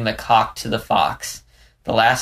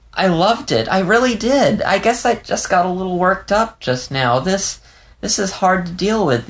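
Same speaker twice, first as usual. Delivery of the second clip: put-on a young fox, voice pitched higher, on the edge of tears; Leo, shaken and tearful; 9 semitones higher than usual. A narrator's reading. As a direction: let Leo's words tremble, lost and confused. Leo's voice, worked up.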